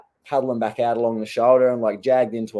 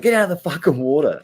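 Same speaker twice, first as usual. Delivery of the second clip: higher-pitched, heated order; retold angry mouthful; 6 semitones higher, 4 dB louder than usual. Surfer ordering a fella to the beach, angry. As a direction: imitate giving someone an earful. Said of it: "I was definitely giving him a mouthful".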